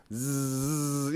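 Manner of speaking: long buzzed "zzz" engine; motorcycle zoom noise. Shown as a demonstration. Describing bikers zipping through traffic, buzzed engine noise. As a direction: buzz like a bike zipping through.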